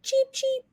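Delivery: high chick peep; chick cheep. Acted. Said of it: "her sound was soft and fuzzy".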